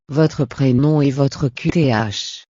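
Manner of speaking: normal talking voice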